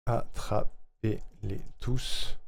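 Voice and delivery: very slow, each syllable set apart. Real, not acted. Streamer working out the jingle rhythm, very slowly, syllable by syllable. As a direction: stretch it out piece by piece.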